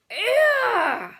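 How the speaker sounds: loud high Ew of disgust; disgusted roar. Acted. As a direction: roar out in revulsion. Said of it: "roared the blood bottler".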